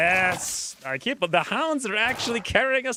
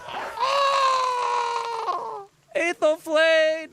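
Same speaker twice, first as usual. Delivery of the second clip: anguished cry; 13 semitones higher, much slower than usual. Real, not acted. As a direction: cry out as your hero falls. Hero hit, anguished cry.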